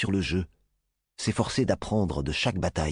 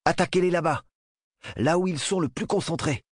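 higher, urgent squad commander's order; battle command; 10 semitones higher than usual. Acted. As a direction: call out the attack order, pitched up. Commander ordering attack in simulation.

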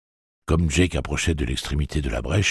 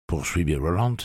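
usual speaking voice

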